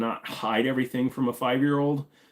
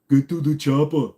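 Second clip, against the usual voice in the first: Austrian accent, low and louder; Arnold impression; 5 semitones higher, 6 dB louder than usual. Shown as a demonstration. Asked by chat, tries Arnold's Austrian accent, too deep. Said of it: "See, I always go too deep".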